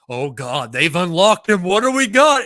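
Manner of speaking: building to a high, loud exclamation; mock awestruck excitement. Cohost recalling his preseason reaction, loud overexcited outburst.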